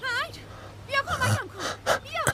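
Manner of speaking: high, drawn-out frightened call; calling for help. Acted. Woman calling to her husband Hank for help, frightened high call. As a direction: stretch out a panicked plea for your husband.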